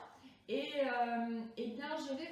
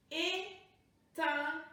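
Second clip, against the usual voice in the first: découpage syllabique; 3 semitones higher, 4 dB louder than usual. Shown as a demonstration. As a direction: announce "Éteindre" syllable by syllable. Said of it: "Combien de syllabes?". (« Éteindre » découpé en syllabes)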